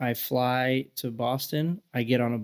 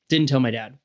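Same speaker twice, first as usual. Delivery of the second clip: usual speaking voice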